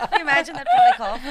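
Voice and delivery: laughing loud and high; laughing while teasing a friend. Real, not acted.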